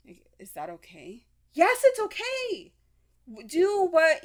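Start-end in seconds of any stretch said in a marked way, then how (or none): 1.5-4.2 high, slow soothing reply; reassuring answer. Acted. To herself, a high slow reassuring yes. Answering her own worried question.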